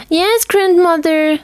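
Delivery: high, slow, sing-song reply; girl calling back to grandmother while running; cheerful girl. A narrator's reading.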